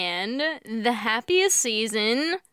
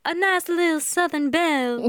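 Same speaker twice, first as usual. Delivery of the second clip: put-on higher, lilting Southern belle accent; 6 semitones higher than usual. Host recalling how she spoke as co-host, Southern belle accent. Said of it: "I spoke as a".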